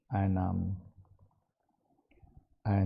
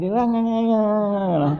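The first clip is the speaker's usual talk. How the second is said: gossip chatter; 9 semitones higher, 4 dB louder than usual. Retold or imitated. Mimicking women gossiping.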